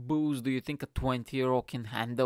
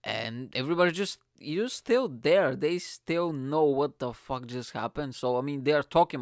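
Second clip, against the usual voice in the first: ordinary talk, nothing marked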